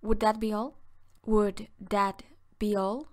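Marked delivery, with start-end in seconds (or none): 1.2-3.1 slowed, deliberate repeat for learners; slow clear teaching repeat; slow and careful. Shown as a demonstration. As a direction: stretch each word out for the class. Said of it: "Se dice".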